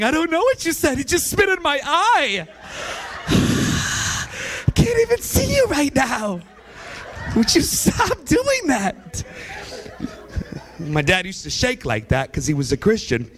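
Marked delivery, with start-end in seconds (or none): 0.0-9.2 put-on crying kid. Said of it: "Standing there crying".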